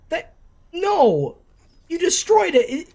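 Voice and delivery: high, loud cry of disbelief; stunned, high-pitched yell; shocked outcry. Real, not acted. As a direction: yell it out in real shock at the screen. Reacting to the Grail's destruction.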